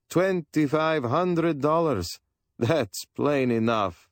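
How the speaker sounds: a hint of an eager dealer musing on price; voiced Sarkis. A narrator's reading. Old dealer telling the family about the rug, lightly voiced.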